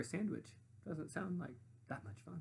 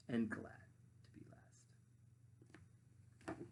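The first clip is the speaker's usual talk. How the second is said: whisper; 3 semitones lower, 11 dB quieter, slower than usual. Acted. Whispered close.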